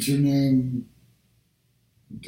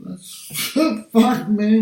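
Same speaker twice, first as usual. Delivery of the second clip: ordinary talk, nothing marked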